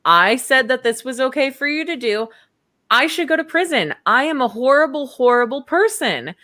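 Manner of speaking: high-pitched, hammed-up panic; pleading in exaggerated distress; mock freak-out. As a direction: act out someone frantically blaming himself. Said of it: "He is freaking out".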